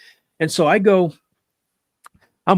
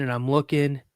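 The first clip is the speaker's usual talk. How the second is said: ordinary delivery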